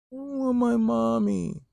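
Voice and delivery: put-on high, slow little-kid whine; whiny toddler voice. Two-year-old daughter in car seat wanting mom, high whiny toddler voice.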